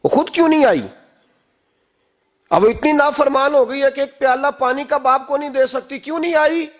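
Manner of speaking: high-pitched indignant outburst; angry. Retold or imitated. Angry father complains to his wife about the disobedient daughter, angry. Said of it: "حضرت عمر بن عبدالعزیز کو غصہ آیا".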